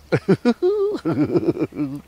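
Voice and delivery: high laugh from somewhere out of sight; frog's laugh. Acted. Hidden frog laughing at the hungry crow, high laugh.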